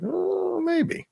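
high, drawn-out doubtful oh maybe; mock hesitant maybe. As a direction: play coy, tempted but unsure. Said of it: "and I was like".

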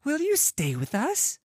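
put-on high, loud boyish outburst; blurted plea. A narrator's reading. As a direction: blurt it out like an eager child. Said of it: "the other blurts out".

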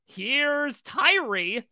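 slow, high Here's Johnny announcement; drawn-out horror-movie entrance line; Jack Torrance. Retold or imitated. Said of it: "in the style of Jack Torrance".

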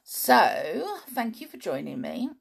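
plain reading voice

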